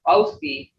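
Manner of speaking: oski with long O and plain S; English-style mispronunciation. Shown as a demonstration. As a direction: say oski as "OH-skee". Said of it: "there's no such word as oski".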